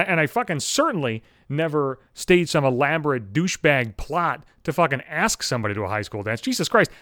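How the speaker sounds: ordinary delivery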